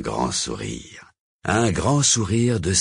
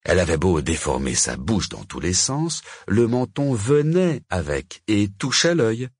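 ordinary delivery

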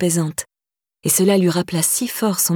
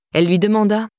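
plain reading voice

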